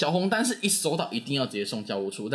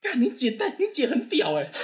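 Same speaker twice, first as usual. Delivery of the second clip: much higher, louder excited exclaim; excited classmate; 12 semitones higher, 3 dB louder than usual. Retold or imitated. Classmate telling him his sister came, higher and louder in awe. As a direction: blurt it out in awe. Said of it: "干嘛".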